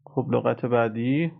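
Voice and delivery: ordinary delivery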